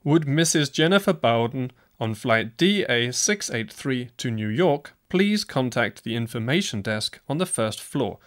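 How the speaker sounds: normal talking voice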